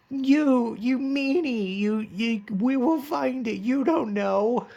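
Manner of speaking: put-on high, childish angry protest; squeaky kid telling off a meanie; mock-angry high kid voice; kids mad at villain. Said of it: "mad at him".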